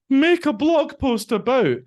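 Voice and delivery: high, mocking robotic singsong; mocking generic prompt. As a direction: sneer at a lazy boring prompt.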